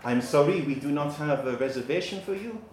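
hammed-up French maître d'; crap French accent. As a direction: apologise as a badly faked Frenchman. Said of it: "crap im-, im-, impression of a Frenchman".

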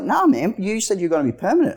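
high, whiny mock of the boss; a mocking take on the boss weaselling out. Boss Doug refusing pay, mimicked.

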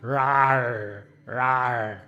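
drawn-out mock roar; playful lion roar. Conductor roaring at her reflection in the glass, playful. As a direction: growl like a toy lion. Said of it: "saw my reflection".

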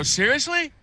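high-pitched retold surprise; disbelieving. Bowler retelling his reply to a cheap offer, disbelieving. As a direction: question it in mock disbelief.

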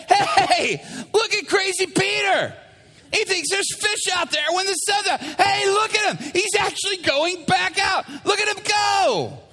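high, hollered mockery; jeering crowd. Crews jeering crazy Peter from shore, mocking. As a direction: jeer at him in a sing-out high voice. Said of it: "And they're like".

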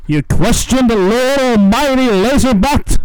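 loud, far villain roar; booming villain shout. Acted. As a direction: bellow it like a mad god. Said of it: "Wow, that sounded great".

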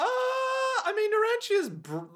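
high, slow incredulous protest. Real, not acted. Friend objects in high disbelief.

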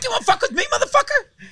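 very high, loud shrieking voice; high-pitched scream. Pretend. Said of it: "I just talk in a high voice and I fucking scream".

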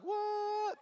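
high-pitched mock astonishment; freaking out. Classmates witness a healing, imitated high shocked cry. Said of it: "people are freaking out".

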